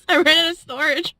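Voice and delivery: ordinary talk, nothing marked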